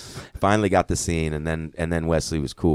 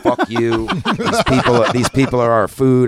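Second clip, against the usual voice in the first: loud, higher menacing vampire villain line; villain's snarl; 8 semitones higher, 10 dB louder than usual. Retold or imitated. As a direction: bark it out like the film's villain, with a laugh in it.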